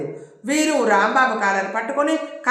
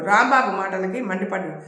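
plain reading voice

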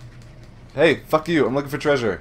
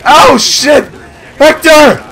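sudden high panicked shouts, much louder; startled yell; 14 dB louder, much slower than usual. Real, not acted. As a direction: yell out in real alarm as the fight erupts. Startled in a firefight.

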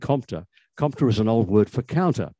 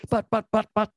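much higher, stammering the buts in outrage; indignant splutter; 12 semitones higher than usual. A narrator's reading. Vox protesting his innocence to Bromley, high indignant splutter. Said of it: "spluttered Vox in indignation".

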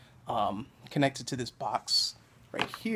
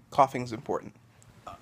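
ordinary talk, nothing marked

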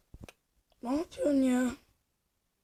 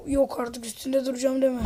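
ordinary delivery

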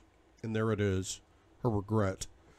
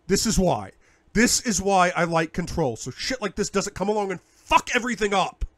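louder, higher angry shouting; 8 semitones higher, 7 dB louder than usual. Acted. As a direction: hammer your point home. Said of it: "please don't shout at me".

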